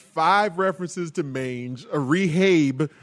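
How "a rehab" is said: put-on Chicago accent. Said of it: "my Chicago accent".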